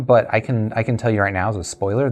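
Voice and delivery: normal talking voice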